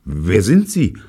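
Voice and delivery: high, loud, startled stammer. A narrator's reading. David facing frightening strangers at the door, stammering. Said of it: "stammelte David".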